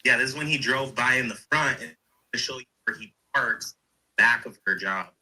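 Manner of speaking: ordinary delivery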